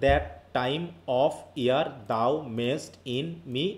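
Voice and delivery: very slow, each word of "That time of year thou mayest in me" set apart; syllable by syllable. Shown as a demonstration. Teacher dividing a verse line for pupils, "That time of year thou mayest in me" syllable by syllable. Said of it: "তাহলে এক একটা syllable না".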